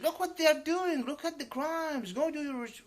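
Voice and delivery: high, loud mocking of the commenter; loud mock imitation of the commenter; mocking loud quote. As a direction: throw his words back at him, shouting. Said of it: "you said".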